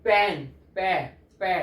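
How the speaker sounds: पेन, then the पे sound pulled out loud and separate; sounding out the first letter. Shown as a demonstration. Teacher showing students the first sound, drawn out. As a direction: isolate the opening पे of पेन for the class. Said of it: "आवाज sound क्या आ रहा है".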